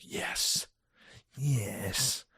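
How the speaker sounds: breathless whispered yes, yes. Retold or imitated. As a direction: hiss the yeses out in quiet delight. Fired up by staff praise.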